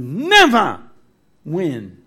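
emphatic preacher's shout on "never". Real, not acted. Preacher declaring the devil's defeat to the congregation, shouting. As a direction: shout it out with full conviction. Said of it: "I don't know why I'm shouting".

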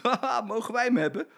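a giggly high ask; child giggling as he asks; laughing, high child's voice. Acted. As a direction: beg through a laugh. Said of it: "zegt hij lachend".

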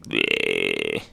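low, soft, drawn-out noise; imitated worn-out bleh; tongue-out bleh. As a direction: droop it out like a lolling tongue. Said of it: "his tongue's like".

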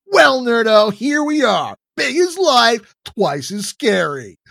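loud, high jeering bully; mocking bully. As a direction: taunt him with a grin. Bully shoves and mocks Todd.

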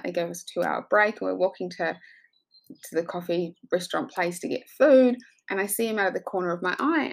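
ordinary talk

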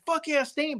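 high-pitched retold hype; pumped up. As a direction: imitate your own thrilled fan reaction. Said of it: "I was pumped".

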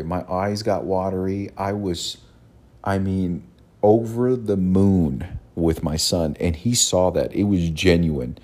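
usual speaking voice

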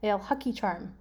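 Ale huck each arm run together. Shown as a demonstration. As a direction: blend the words into one phrase. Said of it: "It's better if I read it fast".

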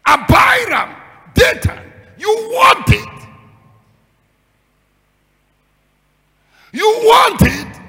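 high, slow shouted charge; shouted accusation. Acted. Preacher accusing Korah's rebels with a high, slow shout. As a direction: hurl the accusation, drawn out.

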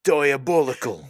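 loud, high mock-Cockney shout; Butcher with badly faked accent. Co-host copying the Butcher impression, loud hammed-up British accent. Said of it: "gonna do my best butcher here".

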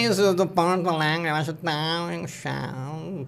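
slow, droning sing-song Porto Alegre drawl; mock the Porto Alegre accent with stretched vowels. Saying Porto Alegre's accent is annoying, in its droopy drawl. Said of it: "É meio molão".

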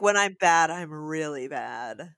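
a creepy kid's whispered-down boast, lingering on really bad; menacing boy. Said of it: "'Cause he's like".

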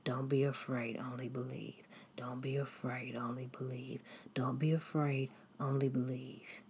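soft, hushed repeated mantra; whispered to yourself. Retold or imitated. Repeating Jesus' words to yourself in your dream, hushed whisper. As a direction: murmur it over and over to yourself. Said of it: "you repeated it to yourself over and over".

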